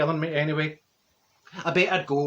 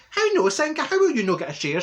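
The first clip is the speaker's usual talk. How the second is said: raised, high-pitched acted protest; indignant disbelief; 10 semitones higher, 4 dB louder than usual. Asking Senga why she gets no share, indignant. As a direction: demand an answer, incredulous.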